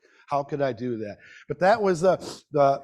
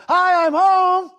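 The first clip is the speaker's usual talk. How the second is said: put-on high, slow and loud little-girl greeting; child voice; 14 semitones higher, 10 dB louder, slower than usual. Mother voicing her young daughter bursting in the door, high child voice. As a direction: call it out like a cheerful third grader coming home. Third grader daughter arriving home.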